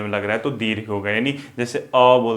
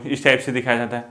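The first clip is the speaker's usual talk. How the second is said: usual speaking voice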